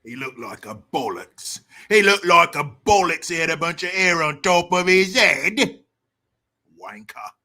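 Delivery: hammed-up Cockney accent; exaggerated Cockney, swelling then dropping off; mock British accent. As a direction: jeer in a put-on Cockney accent. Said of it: "making fun of these damn accents".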